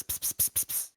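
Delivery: whispered pss cat call; unvoiced pss to call a cat; cat calling hiss. Shown as a demonstration. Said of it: "Tell your cat I said pss".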